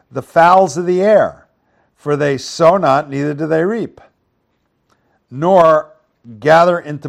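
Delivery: loud, projected Bible reading; forceful, emphatic reading aloud; scripture reading. Acted. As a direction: proclaim the verse to the congregation.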